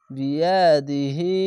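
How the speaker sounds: slow বিয়া দি হি, last vowel held; long vowel. Shown as a demonstration. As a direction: draw out the ending of বিয়া দি হি. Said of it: "খার জেরে এক আলিফ টেনে পড়বেন". (বিয়া দি হি-র শেষটা এক আলিফ টেনে)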